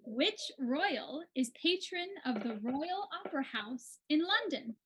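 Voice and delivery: ordinary delivery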